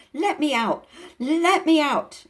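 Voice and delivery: high, loud furious yelling; furious shout. Acted. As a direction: pound and yell to be freed. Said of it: "he was shouting".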